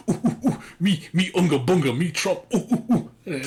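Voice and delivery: mock a politician as a grunting chimp; Donald Trump as chimpanzee. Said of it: "that's what it sounds like".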